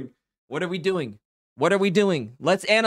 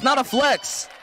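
mock scolding; 7 semitones higher, slower than usual. Real, not acted. Scolding donor.

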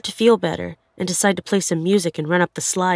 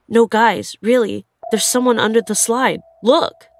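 louder, higher urgent warning to friends; urgent insistence; 4 semitones higher, 6 dB louder than usual. Retold or imitated. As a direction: insist urgently, as if they don't believe you.

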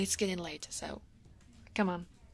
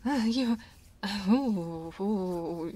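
pleased moans; slower than usual. Acted. Woman getting a shoulder massage from coworker, pleased moans. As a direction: melt under the massage.

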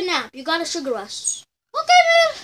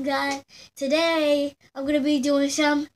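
usual speaking voice

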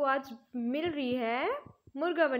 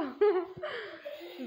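real laugh; 4 semitones higher than usual. Real, not acted. Teacher laughing at the girl crowing to her seat, real laugh.

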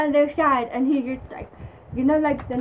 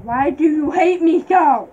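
dramatic lament; 4 semitones higher, 7 dB louder, slower than usual. Acted. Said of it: "repeats dramatic lament".

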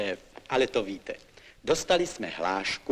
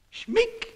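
snip sound. Acted. Mimics the snip of putting down.

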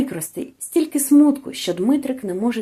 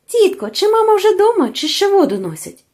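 put-on high, louder boyish call to an aunt; 9 semitones higher, 5 dB louder than usual. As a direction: call out an urgent question, high then dropping. Хлопчик гукає жінку, підбігаючи. (схвильований оклик, високо й голосно)

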